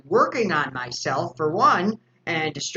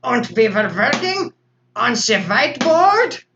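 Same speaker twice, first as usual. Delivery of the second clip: put-on German doctor accent; 5 semitones higher, 6 dB louder than usual. Doctor character on his live stream, German accent. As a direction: ham up a German doctor lecturing at a whiteboard. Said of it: "I was doing this accent".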